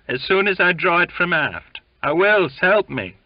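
high wheedling plea; whining. A narrator's reading. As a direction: coax him in a whine. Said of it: "he whined in a conciliating voice".

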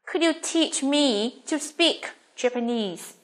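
slow English, phrase by phrase; slow model reading. Shown as a demonstration. Teacher asking students to hear her read, slow model question. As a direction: read it slowly and carefully for learners.

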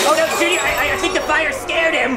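high-pitched worried cry; alarmed. Acted. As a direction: call out in alarm after a runaway. Monster runs from the fire.

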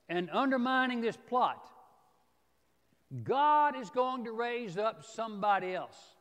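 emphatic warning driven home from the pulpit; forceful preaching. Acted. Preacher voices Mordecai warning Esther about refusing, forceful preaching.

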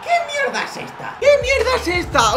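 very high, squealed complaint; high-pitched incredulous whine; squeaky disbelief. Real, not acted. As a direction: squeal it in falsetto, half laughing. Conceded goal, squeaky disbelief.

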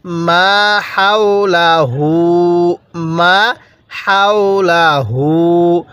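মা হাওলাহু chanted slowly with a breathy হা; teacher models the হা for students; clear হা sound. Shown as a demonstration. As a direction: push light air out on the হা. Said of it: "আমি মুখের মধ্যে একটা আওয়াজ করছি".

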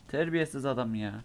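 ordinary talk, nothing marked

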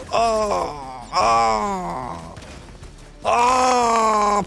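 sharp cries of frustration; frustrated cries. Real, not acted. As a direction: yelp at the screen, rising. Frustrated cries while playing.

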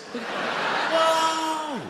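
very high, panicked mock outcry; hammed-up high panic; alarmed outcry. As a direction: yelp high, then stammer and slow right down. Shocked outcry at the ad.

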